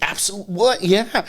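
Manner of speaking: high-pitched retold amazement; thrilled. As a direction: act out jumping at the offer. Acts out thrilled reaction to show.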